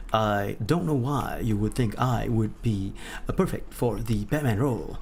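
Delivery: low, halting hero delivery; mock hero voice. Streamer doubting fitness for the Batman role, low halting hero voice. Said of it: "Why do I speak like frickin'?".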